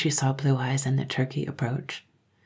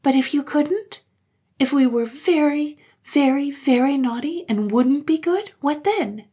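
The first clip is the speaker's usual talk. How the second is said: put-on high, louder pressing child; 10 semitones higher, 5 dB louder than usual. Children pressing mother, high and anxious.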